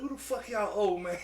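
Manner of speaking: country alien dude. Retold or imitated. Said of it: "a little country ass, uh, alien dude".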